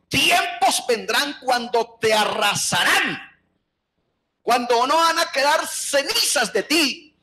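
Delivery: rising, slowed cry of doom; prophetic shout. Acted. Jesus prophesies destruction on Jerusalem.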